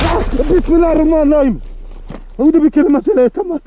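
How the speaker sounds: fast, high, loud frantic prayer; panicked prayer. Real, not acted. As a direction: rattle the prayer off in terror. Panicked prayer after a sound.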